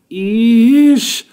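high, loud anguished wail; a mother's shocked wail; wailing eesh. A narrator's reading. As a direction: cry it out in dismay. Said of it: "Mother wailed".

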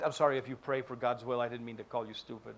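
ordinary delivery

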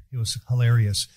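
usual speaking voice

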